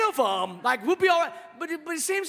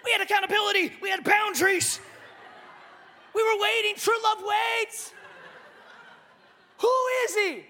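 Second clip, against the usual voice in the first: hammed-up betrayed fiancé, pitch up; a comic, high-pitched "who is he"; mock outraged Joe, higher; 8 semitones higher than usual. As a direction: play the jilted groom's indignation for laughs.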